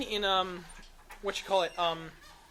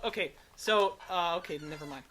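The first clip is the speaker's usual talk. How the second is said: normal talking voice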